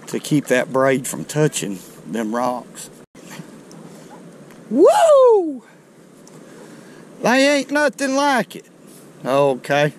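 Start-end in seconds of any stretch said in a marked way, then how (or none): 4.7-8.6 high, loud joyful whoop then gushing; whooping with real joy over the catch; excited whoop. Real, not acted. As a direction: let out a thrilled yell of real delight. Landing big flathead catfish.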